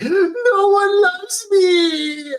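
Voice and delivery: high, slow, hammed-up sobbing wail; mock crying wail. Friend voicing Sporking's fake sobbing plea, high wail. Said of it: "Sporking was like".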